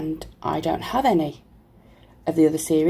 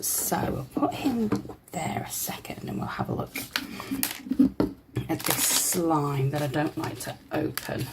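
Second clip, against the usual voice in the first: ordinary delivery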